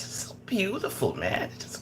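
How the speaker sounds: whispered in tender awe. Real, not acted. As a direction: breathe it out in a whisper.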